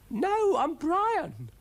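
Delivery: very high, loud, drawn-out protest; high squeaky snail insisting; exasperated snail. Acted. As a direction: wail it slowly in a high voice. Brian insists who he is.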